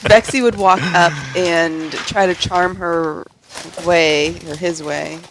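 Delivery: ordinary delivery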